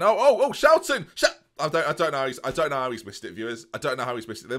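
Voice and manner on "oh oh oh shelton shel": real high, loud outburst; excited commentary shout. Real, not acted. Streamer reacting to a near miss, shouting. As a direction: burst out as the chance comes.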